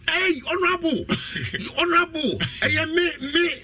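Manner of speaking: high-pitched eager hail down the phone; imitated caller. Caller hails the honourable on loudspeaker, high mimicked greeting.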